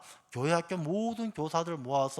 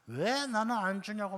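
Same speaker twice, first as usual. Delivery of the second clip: higher-pitched mock complaint; mild yell, pitched up; retold drunken yelling; 5 semitones higher than usual. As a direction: imitate an aggrieved drunk protesting. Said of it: "막 소리 지르는 거예요".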